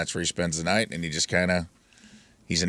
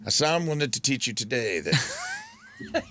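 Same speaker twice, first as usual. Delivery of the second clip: higher and slow, a sage teaching a lesson; mock wise master; 7 semitones higher, slower than usual. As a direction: deliver it like a serene guru. Mimics the neighbor lecturing him.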